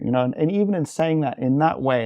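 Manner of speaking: normal talking voice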